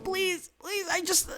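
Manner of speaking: very high, pleading voice; feeble begging. Acted. Dying Jessica begging Roman, high pleading voice. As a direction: beg weakly, cut off mid-sentence.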